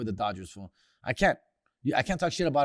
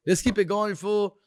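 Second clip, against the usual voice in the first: ordinary talk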